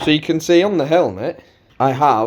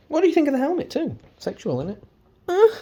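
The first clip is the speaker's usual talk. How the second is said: high, fast, jokey voice, then hushed; silly put-on voice; 4 dB quieter, faster than usual. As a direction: tease the viewers in a goofy voice. Shows off helmet jokingly.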